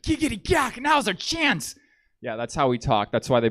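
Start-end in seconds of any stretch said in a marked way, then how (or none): 0.0-1.7 high-pitched cartoonish grandpa voice; grandpa impression. Grandpa to the French prisoner, plotting escape, high silly voice. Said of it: "my grandpa looks at this French guy, and he's like".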